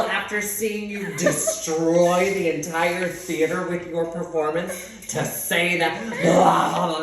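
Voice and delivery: hammed-up indignant rant, opening loud; outraged mom. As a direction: act out a mother appalled on her child's behalf. Acts out mom's outraged rant.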